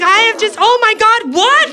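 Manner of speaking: high, shocked disbelief rising. Retold or imitated. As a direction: sputter in horror. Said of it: "I'm like".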